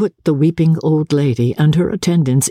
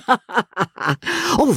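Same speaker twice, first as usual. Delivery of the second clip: laughing; 10 semitones higher than usual. A narrator's reading. Laughing exclamation.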